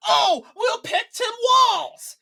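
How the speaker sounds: slow, high, loud mock cheer; mocking shout. Mocking the Democrats' pick, slow loud mock shout. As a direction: ham up their cheering for Walz.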